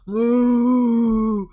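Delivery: loud imitated cow moo. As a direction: moo like a cow crawling on all fours. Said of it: "we'd all crawl and go".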